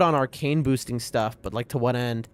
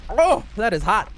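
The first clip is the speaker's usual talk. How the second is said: startled by scalding tea; hot tea gasp; 5 semitones higher than usual. Real, not acted. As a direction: react to a sudden burn. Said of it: "Burnt my tongue".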